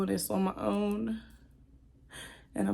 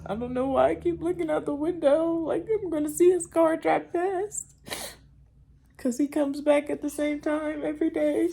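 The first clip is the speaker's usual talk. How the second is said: high, tearful, sobbing at the end; real tears she can't hold back; crying; 6 semitones higher than usual. Real, not acted. Watching the window for her husband's car, crying. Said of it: "try to keep crying on camera".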